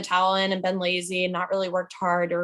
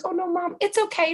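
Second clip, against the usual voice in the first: much higher little cat voice; voicing the cat; 10 semitones higher than usual. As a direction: play the sweet cat comforting mom. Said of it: "Cheeto's like".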